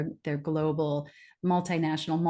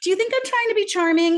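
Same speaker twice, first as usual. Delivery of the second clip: much higher and louder, incredulous and offended; indignant retort; 6 dB louder than usual. Retold or imitated. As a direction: fire it back in disbelief.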